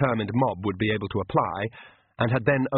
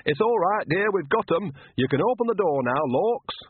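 fake Nanny Ogg; 8 semitones higher than usual. Vampire faking Nanny through the door, higher granny voice. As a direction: put on a chummy old granny voice, pitched up. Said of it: "Then Nanny Ogg's voice said".

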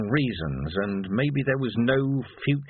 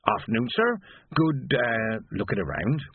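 chatty porter. Acted. Porter Maroon speaks.

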